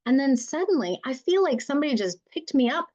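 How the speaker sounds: high-pitched, acted-out bewilderment; bewildered. Client telling the mediator how lost they feel, bewildered. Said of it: "they are completely bewildered".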